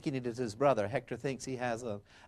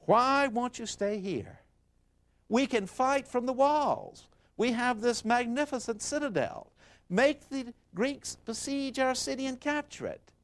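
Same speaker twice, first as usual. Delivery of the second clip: pleading wife; 10 semitones higher than usual. A narrator's reading. Wife begging her husband not to fight, high pleading. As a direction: beg him to stay inside the walls. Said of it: "And she says".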